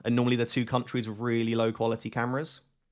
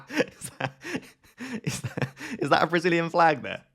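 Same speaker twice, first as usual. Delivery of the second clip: laughing; 5 semitones higher than usual. Real, not acted. Excited spotting a flag mid-laugh.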